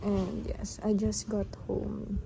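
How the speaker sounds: tired, slow and drained; worn out after a long day; exhausted. Real, not acted. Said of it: "my actual state right now".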